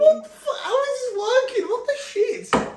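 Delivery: very high, half-laughing astonishment; stunned disbelief. Real, not acted. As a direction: squeal it, unable to believe it. Stunned that flirting works.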